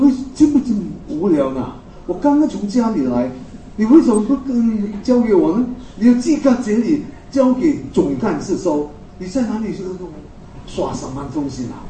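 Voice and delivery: a rising, exasperated complaint; angry retort. Retold or imitated. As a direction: snap at him in sudden anger. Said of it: "我那种气就来了".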